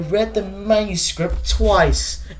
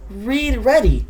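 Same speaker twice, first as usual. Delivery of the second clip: ordinary talk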